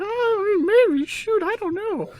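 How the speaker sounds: very high, squealed fluster; hammed-up falsetto surprise. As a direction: squeal it like you're caught off guard. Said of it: "he was just surprised".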